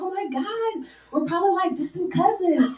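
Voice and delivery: high-pitched, gushing excitement, drawing out the last words; excited coworker. Retold or imitated. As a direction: squeal it like a thrilled coworker. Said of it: "She was so fucking excited".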